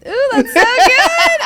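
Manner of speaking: high, slow and laughing; laughing delight. Real, not acted. Host delighted by her guest's words, laughing as she praises them. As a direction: savour it with a laugh.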